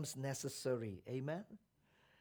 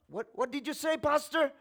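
raised, high-pitched demanding question; acted irritated "come again?"; angry man; 8 semitones higher, 6 dB louder than usual. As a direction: ask sharply, rising to the last word. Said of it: "And the angry man".

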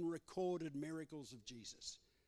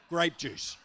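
punchline; 9 dB louder than usual. Real, not acted. Joke punchline about juice.

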